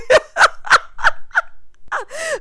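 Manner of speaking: high, loud cackling laugh; laughing hard. Real, not acted. Laughing at her own joke while recapping, high cackle.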